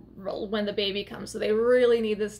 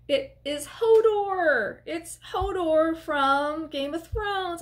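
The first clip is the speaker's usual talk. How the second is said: slow, higher delighted reveal; excited reveal; 5 semitones higher, much slower than usual. Real, not acted. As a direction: announce the surprise with joy. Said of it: "My heart sang".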